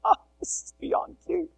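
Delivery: high gushing coo; high delighted gush. Retold or imitated. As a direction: coo over something adorable. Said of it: "you're just like going".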